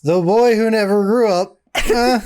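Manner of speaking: put-on high, boyish storybook-hero voice; Peter Pan. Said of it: "Peter Pan".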